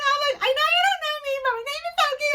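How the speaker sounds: very high, squeaky fangirl gush; gushing fan introduction. Retold or imitated. Fan introducing herself to Irene, gushing and squeaky.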